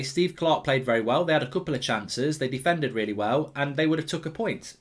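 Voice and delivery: ordinary talk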